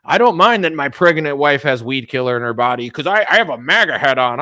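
mocking a MAGA fan. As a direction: parody a proud, clueless supporter. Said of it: "this is what grown men do now".

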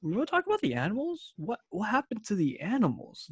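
high, fast, incredulous squeal; indignant, sky-high disbelief; mock outrage. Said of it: "where I'm like".

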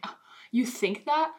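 usual speaking voice

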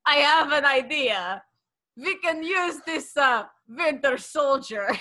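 mock Russian villain accent. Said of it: "you have to do a Russian accent".

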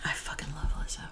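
hushed, breathy fan confession; whispering. Real, not acted. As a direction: whisper it like a secret crush. Gushing about a favourite singer.

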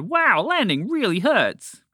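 high, slow, gleeful exclamation; happy. A narrator's reading. Mister Toucan after his first crash landing, happily. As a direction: relish each word, high and delighted. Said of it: "happily said".

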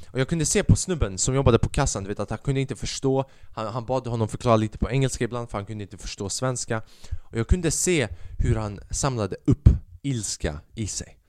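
ordinary delivery

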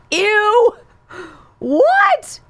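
high squealing ew, then a stunned what; recoil, then ask in disbelief; disgusted surprise. Real, not acted. Host reacting to the president's clip with disgust. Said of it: "reacting to the clip".

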